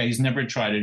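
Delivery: normal talking voice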